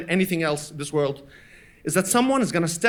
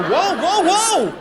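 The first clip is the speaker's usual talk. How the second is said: high-pitched startled whoas; squeaky protesting child; alarmed kid; 8 semitones higher, 5 dB louder, much slower than usual. Retold or imitated. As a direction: back off in rising mock alarm. Child alarmed by mother's outburst.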